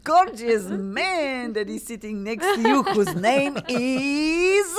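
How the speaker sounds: high, slow, drawn-out cue; playful introduction build-up. Acted. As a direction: stretch the last word out to hand over. Teasing build-up to husband's introduction.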